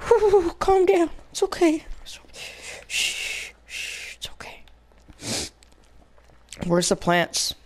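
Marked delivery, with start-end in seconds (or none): 0.1-4.6 high voice fading to a hushed shush; calm yourself down, sinking to nearly a whisper; self-soothing. Real, not acted. Player soothing themself after a rage, fading hush.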